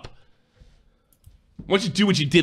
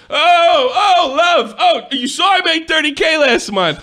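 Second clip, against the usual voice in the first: mock flirty shout; 11 semitones higher, 4 dB louder than usual. Mocking target flirting, shouting.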